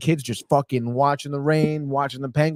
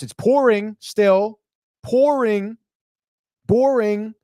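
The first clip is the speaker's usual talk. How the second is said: high sing-song pouring; 8 semitones higher, 3 dB louder, slower than usual. Shown as a demonstration. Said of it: "wordplay on pouring".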